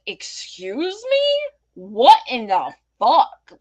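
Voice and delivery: slow, high, incredulous retort; drawn-out disbelief at his nerve; indignant comeback. Retold or imitated. Retorting to rude stranger.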